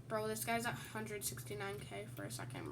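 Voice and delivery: normal talking voice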